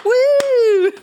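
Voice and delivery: laughing, high cheer. Real, not acted.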